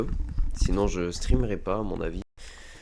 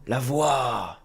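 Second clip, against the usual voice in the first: louder, drawn-out exclamation; dramatic exclamation; 3 semitones higher, 4 dB louder than usual. Acted. Player repeating that he hears the voice, as a dramatic exclamation. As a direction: stretch out "voix" and swell it dramatically. Echoes mysterious voice in game.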